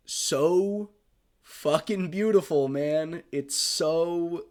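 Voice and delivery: overcome, blurt it out on a rising wave; tearful gush. Real, not acted. Praising the reunion scene, crying and gushing. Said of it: "I get emotional talking about this movie".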